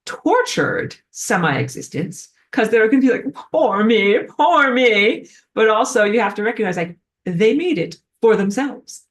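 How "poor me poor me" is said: high, loud mock wail; mock self-pity. Semi-existent beings begging for pity, mock woeful. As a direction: moan theatrically for sympathy.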